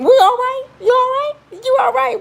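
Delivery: high, loud menacing sweet check-in; mock-sweet high menace; crazy-eyes warning. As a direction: ask it with crazy eyes, threatening. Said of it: "I give him the crazy eyes".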